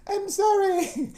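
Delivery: tearful squeaky-high mock British apology, drawn out; mock British accent. Child apologizing at the lady's door, mock-crying British accent. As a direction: wail it very high and slow in a put-on British accent. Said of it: "That was my little British accent".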